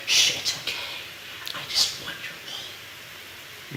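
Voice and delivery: faint unvoiced whisper to the sheep. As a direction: hush it gently, barely voiced. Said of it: "Which the microphone did probably not pick up".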